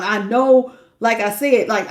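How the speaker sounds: ordinary talk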